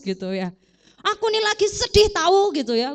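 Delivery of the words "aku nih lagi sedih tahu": mock indignant outburst. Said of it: "dia nggak marah".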